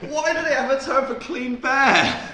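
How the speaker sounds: baffled disbelief. Real, not acted. Friend baffled by the Swedish term, high incredulous question. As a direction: ask in amused disbelief.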